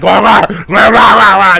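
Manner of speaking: loud mock wah wah babble; playful loud nonsense jabber; excited chatter. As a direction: imitate noisy kids at the window. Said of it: "and it's like".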